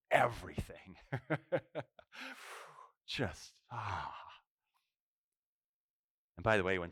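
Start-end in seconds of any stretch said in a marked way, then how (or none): 0.1-4.1 whispered in wonder; hushed amazement at feeling again; awed whisper. Retold or imitated. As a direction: let the word come out as breath. Leper feels everything again.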